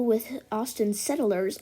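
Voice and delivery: normal talking voice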